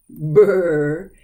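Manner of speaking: loud shivering brr. Shown as a demonstration. Teacher showing where the shivering brr goes.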